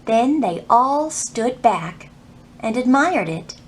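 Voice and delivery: gentle read-aloud storytelling voice; story narration. A narrator's reading. Librarian reading to kids at story time, storytelling voice. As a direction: lead the children through the story. Reads picture book aloud.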